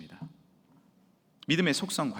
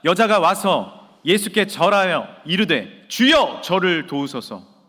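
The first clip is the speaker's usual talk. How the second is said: loud congregational reading; 7 dB louder, slower than usual. Shown as a demonstration. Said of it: "시작".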